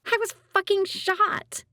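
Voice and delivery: high, sharp snap of frustration; snapping. Acted. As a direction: bite the words out in disbelief. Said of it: "Abby snaps".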